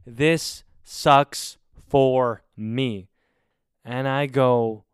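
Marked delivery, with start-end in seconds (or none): 0.1-3.1 very slow, drawn-out mock whine. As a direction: stretch every word like a sulking roommate. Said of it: "and he goes".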